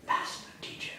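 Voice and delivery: breathy whisper, barely voiced; whispered awe. Mary answering the risen Jesus in a whisper. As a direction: hush it like a revelation.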